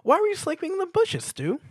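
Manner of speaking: very high, loud bubbly pony voice; Pinkie Pie. A narrator's reading. Pinkie Pie greeting Stuart with a loud high squeal. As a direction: squeal the welcome like an overexcited pony.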